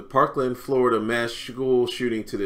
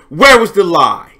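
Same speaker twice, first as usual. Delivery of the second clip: loud, high gloating challenge; taunting challenge; 7 semitones higher, 14 dB louder than usual. Real, not acted. Host taunts the columnist after the clip, loud gloating. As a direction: throw the question at him triumphantly.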